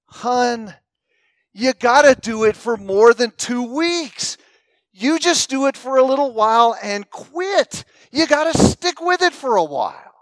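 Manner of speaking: a husband losing patience with his wife; exasperated husband. Retold or imitated. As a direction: press the advice, exasperated. Exasperated husband pushing his advice.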